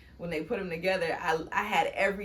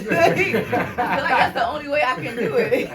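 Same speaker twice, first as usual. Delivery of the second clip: louder, laughing all the way through; 6 semitones higher, 13 dB louder than usual. Real, not acted. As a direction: let the laughter carry the words. Laughing at studio story.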